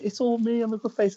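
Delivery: high-pitched retold protest; mock protest. Wrestler recounting the guys' claim, mock high protest. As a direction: put on a high, complaining voice.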